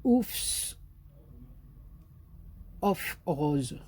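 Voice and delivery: "Oufs of Rose" with a French accent, English lost. Shown as a demonstration. Naming the collection to viewers, French accent slipping in. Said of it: "j'ai encore perdu l'accent anglais".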